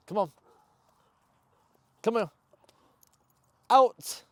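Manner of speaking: high loud command to the dog; sharp command. Real, not acted. Trainer commanding dog to release toy, loud. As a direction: order the dog to drop it.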